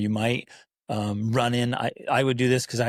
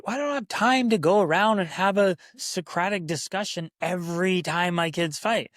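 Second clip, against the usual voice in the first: complaining parent; 7 semitones higher than usual. Retold or imitated. Imagined parent objecting to the advice, high whiny complaint. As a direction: mimic a parent's excuse. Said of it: "I can hear some of you say".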